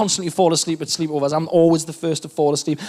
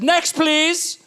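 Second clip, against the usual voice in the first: counter lady; 8 semitones higher than usual. Retold or imitated. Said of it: "the lady behind the counter said".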